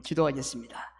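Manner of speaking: earnest, steady prayer voice; fervent pastoral prayer. Real, not acted. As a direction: pray aloud for the congregation. Leads closing prayer.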